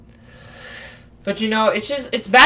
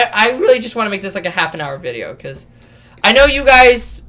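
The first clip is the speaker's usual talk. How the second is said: ordinary talk, nothing marked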